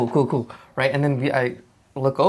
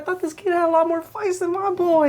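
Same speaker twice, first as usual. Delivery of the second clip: high mocking coach voice; hyped trash-talking coach impression. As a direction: gloat like the coach, pitched way up. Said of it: "he's like".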